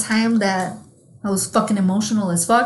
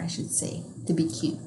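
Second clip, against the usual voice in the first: breathy whisper, tossed off to the side; an unvoiced whispered aside; 6 semitones lower, 12 dB quieter than usual. Real, not acted. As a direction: drop to a whisper for the joke.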